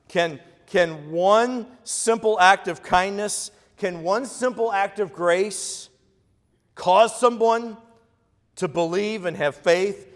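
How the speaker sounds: slow preaching crescendo; slow building emphasis. Real, not acted. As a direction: lean into each word, building. Slow building sermon climax.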